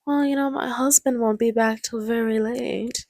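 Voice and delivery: hint at it as a teasing seductress; imitating a coy older woman; mock seductive hint. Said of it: "Missus Robinson gives out a little".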